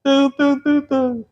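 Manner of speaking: high imitated background beat; mock sappy beat. Preacher mocking sappy gospel backing music, high. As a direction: hum the syrupy backing drum. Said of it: "Bota um fundo meloso".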